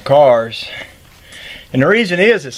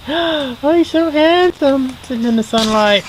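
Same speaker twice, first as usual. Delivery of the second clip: much higher, slow adoring coo; gushing over the dog; 12 semitones higher than usual. Real, not acted. Admiring his dog Charlie in a much higher, slow coo. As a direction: swoon over how handsome he is.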